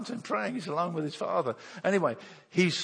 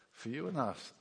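usual speaking voice

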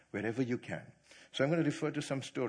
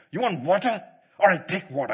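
angry Moses; 5 semitones higher, 11 dB louder than usual. Retold or imitated. Moses angrily striking the rock.

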